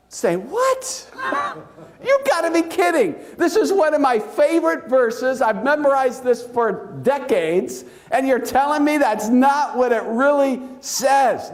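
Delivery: high-pitched, hammed-up incredulity; mock outraged astonishment; shocked disbelief. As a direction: protest as if you can't believe your ears. Acting out his shocked first reaction.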